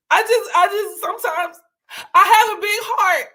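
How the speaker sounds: high, hammed-up wailing with a cry in it; mock crying. Joking to the audience about her big heart, hammed-up crying. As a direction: wail it out like a fake breakdown.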